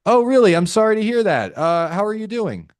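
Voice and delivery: high, loud, uncaring chirpy sympathy; salesman's upbeat curiosity at bad news; exaggerated high-pitched interest; misplaced curious tone. Shown as a demonstration. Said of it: "With a curious tone".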